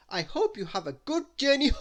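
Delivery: high, giggling through words; giggled. A narrator's reading. Mister Arthur Loaf, tickled while saying goodbye, giggling. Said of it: "he giggled".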